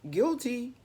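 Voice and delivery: usual speaking voice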